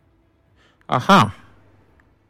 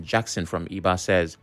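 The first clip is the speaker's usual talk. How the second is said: ordinary talk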